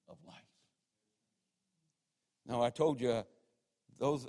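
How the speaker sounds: ordinary talk, nothing marked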